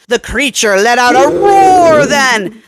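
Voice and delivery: ordinary delivery, nothing marked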